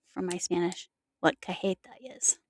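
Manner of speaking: a quick hushed aside; whispered aside. Real, not acted. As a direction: whisper "from my Spanish what cajeta is" to herself. Aside to herself.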